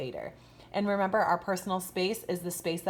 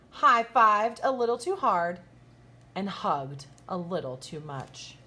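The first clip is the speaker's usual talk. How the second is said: lively, bright storybook read-aloud with big swoops; reading to children; 3 semitones higher, 6 dB louder than usual. A narrator's reading. Teacher reading a picture book to her kindergartners, animated storybook voice. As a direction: read it like story time for little kids.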